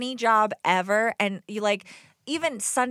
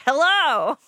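calling out; 7 semitones higher, 6 dB louder than usual. Retold or imitated. Calling out arriving home.

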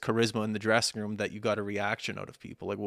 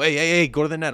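higher, louder call to a teammate; loud, higher imitated shout to a linemate; mock on-ice yell; 6 semitones higher, 9 dB louder than usual. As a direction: bark it across the rink like a confident player. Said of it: "yell confidently and go".

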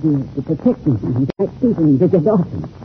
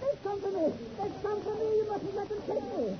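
high, panicked pleading; frightened pleading; 17 dB quieter than usual. Acted. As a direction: beg in terror. Cornered by pursuers, pleading.

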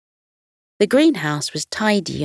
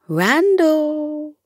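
cooing; 10 semitones higher than usual. Acted. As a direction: coo his name gently. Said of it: "he cooed".

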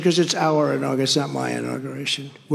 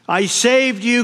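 high, louder anguished cry; bitten woman crying out; 7 semitones higher, 4 dB louder, slower than usual. Acted. The bitten woman cries out to the snake, higher and louder in anguish. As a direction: cry out in shocked betrayal. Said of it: "cried the woman".

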